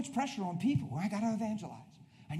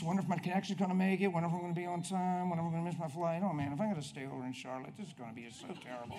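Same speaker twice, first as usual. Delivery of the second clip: mock fretting; faster than usual. Traveler worrying about a connection, mock fretful grumbling. Voices a fretting airline passenger.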